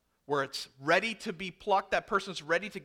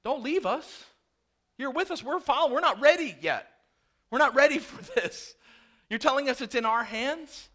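higher, pleading, mock-panicked voices; frightened followers crying out, higher and louder; panicked disciples; 7 semitones higher, 5 dB louder than usual. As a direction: play the disciples begging him not to leave. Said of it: "you gotta wonder if they're looking at each other".

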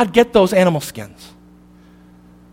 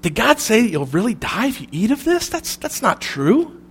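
hushed, sly tempter voice, much softer; sly serpent; 3 semitones higher, 7 dB quieter than usual. Acted. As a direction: whisper the lie like a snake coaxing Eve. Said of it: "He says".

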